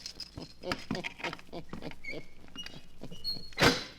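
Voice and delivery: soft breathy villain's laugh; a low, knowing chuckle; sinister chuckle. Acted. Keeper of the book teasing listeners, sinister chuckle.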